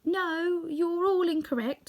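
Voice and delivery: high, meek correction. A narrator's reading. Little man corrects the visitors, high and meek.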